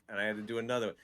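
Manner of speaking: usual speaking voice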